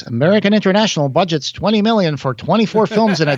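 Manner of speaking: put-on announcer delivery. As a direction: read it out like a trade-news announcer. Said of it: "this is my announcer voice".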